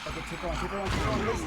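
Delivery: willing it to keep going; urgent pleading. Real, not acted. Urging escape in game.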